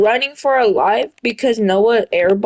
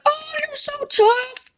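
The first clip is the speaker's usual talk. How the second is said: very high, slow, soft swooning voice; mock swooning ladies; 4 dB quieter, slower than usual. Boy imitating ladies admiring him, high slow mock swoon. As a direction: coo it like an admiring girl. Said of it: "all the ladies are like".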